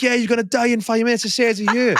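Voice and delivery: high-pitched imitated sister, pressing the point; insistent mock warning. Older sister convincing her brother of the danger, insistent alarm.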